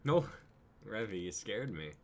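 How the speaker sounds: usual speaking voice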